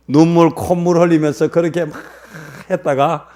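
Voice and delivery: usual speaking voice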